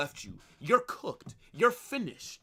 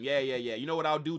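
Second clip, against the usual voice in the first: normal talking voice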